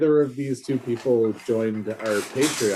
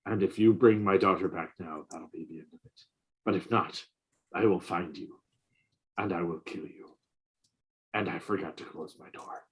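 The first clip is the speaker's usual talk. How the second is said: low, quiet, menacing movie-threat voice; Liam Neeson; 7 semitones lower, 9 dB quieter, faster than usual. Retold or imitated. Mocking a co-host as Liam Neeson, low and quiet. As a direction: growl the threat low and soft, deadly calm. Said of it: "Liam Neeson being like".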